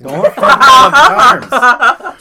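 loud, high, slow repeat through laughter; laughing echo. Pretend. Comic repeats friend's joke, laughing out loud. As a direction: crack up while echoing the gag.

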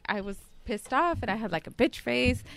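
ordinary delivery

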